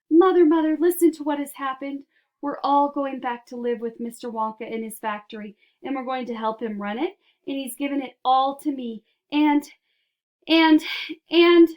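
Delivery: can barely get it out; excited. A narrator's reading. Boy bursting with news.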